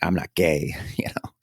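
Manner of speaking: hushed whisper; confiding whisper; whispered aside. Retold or imitated. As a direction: whisper it like a secret. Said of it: "unvoiced whisper".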